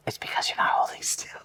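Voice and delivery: hushed, whispered correction; whispered aside. Real, not acted. Co-host needling the one being measured, whispered. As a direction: whisper it, barely voiced.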